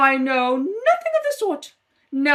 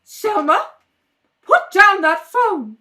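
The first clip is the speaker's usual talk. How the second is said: loud, slow and commanding; aunt sternly ordering the niece off the phone; 8 dB louder, slower than usual. Acted. Aunt catches Selma on phone.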